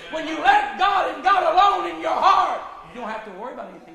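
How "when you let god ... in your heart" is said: high shouted preaching cry; full-voiced shout to the church. Real, not acted. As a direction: let it ring out at full force. Preaching climax.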